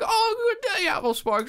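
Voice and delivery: squeaky, very high exaggerated Australian accent; shrill caricature Aussie mother; mock Australian mom. Imitating a friend's mother at the door, high Australian accent. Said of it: "nothing what she sounded like".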